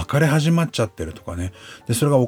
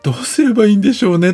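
high, quick, clueless reply; helpless shrug; 8 semitones higher, 4 dB louder, faster than usual. Retold or imitated. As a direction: shrug it off, at a loss. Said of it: "としか思わない自分". (途方に暮れた早口の返事)

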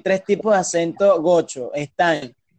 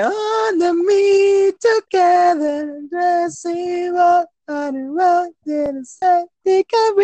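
high, very slow sung-out mock English; mock Mérida singsong; 12 semitones higher, much slower than usual. Mocking Mérida people who sound like musicals, high slow singsong. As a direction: stretch every word high like a musical number. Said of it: "los de Mérida hablando que".

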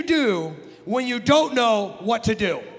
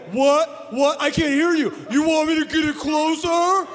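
loud, higher-pitched idiot taunting; stupid idiot act; 5 semitones higher, 4 dB louder than usual. Retold or imitated. Brother daring his little sister with a lighter, mock idiot shouting. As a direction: play the clueless idiot, pushing it louder. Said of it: "doing my stupid idiot what, what thing".

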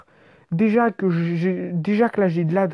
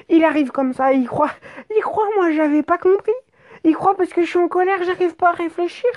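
high-pitched incredulous rant; mock the very idea in a much higher pitch; scornful outburst; 10 semitones higher than usual. Rider scoffing that the cop underestimated them, high-pitched indignation.

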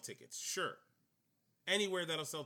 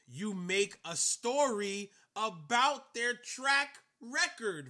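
restrained near-shout, louder and higher; holding back a shout; 6 semitones higher, 6 dB louder, slower than usual. Real, not acted. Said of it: "I'm trying not to scream".

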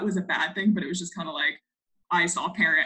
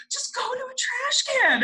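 very high-pitched retold panic; hammed-up squeal of an order; mock urgent order. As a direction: imitate a teacher urgently sending a kid off. Teacher's urgent order, acted in retelling.

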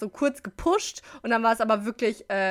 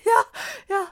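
high-pitched, louder yes; retold high yelp of agreement; mock eager reply; 13 semitones higher, 5 dB louder than usual. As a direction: squeak out a worn-out runner's yes. Acts own reply to fellow runner.

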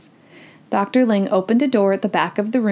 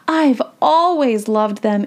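slow, drawn-out gush, lightly acted; excited little girl; 3 semitones higher, 3 dB louder, slower than usual. A narrator's reading. Annie tells an older woman she loves pandas, gushing. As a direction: gush with a touch of girlish delight.

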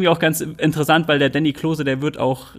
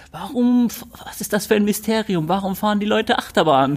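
mock puzzled; 5 semitones higher than usual. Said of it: "man fragt ja jetzt auch nicht so".

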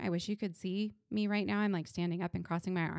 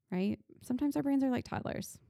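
ordinary talk

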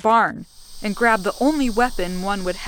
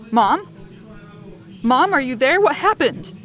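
yelling into the phone; 4 semitones higher than usual. A narrator's reading. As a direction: call out to her, higher at the end. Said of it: "I yelled".

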